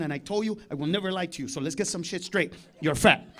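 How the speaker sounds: low, soft, controlled lecture; dad turning tables. Acted. Dad arguing back at mom, low controlled indignation. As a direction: lay down the argument in a low voice. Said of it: "my dad just flipped it on her".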